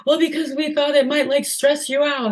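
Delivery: high-pitched imitation of a friend's hesitant excuse; friend's excuse, retold. Friend explaining why there was no shower, in a high mock-sheepish voice. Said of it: "And she was like".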